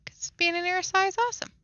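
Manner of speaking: high, fast mock enthusiasm; sarcastic gushing. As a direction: gush about it with an eye-roll. Mocking the Erisai's love of themselves.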